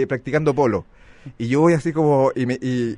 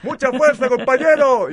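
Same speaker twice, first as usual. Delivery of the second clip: higher, louder patronizing cheer; cheery high encouragement; 12 semitones higher, 6 dB louder, slower than usual. Retold or imitated. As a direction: cheer him on like a charity case. Gente lo anima con piedad. (ánimo condescendiente, agudo y fuerte)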